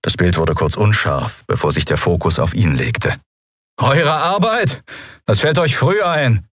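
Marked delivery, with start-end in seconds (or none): 3.8-6.5 high, scornful calling; angry ringleader shout. A narrator's reading. Bearded ringleader taunts the captain, calling out. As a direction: call it out for the crowd. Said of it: "rief der Rädelsführer der Menge".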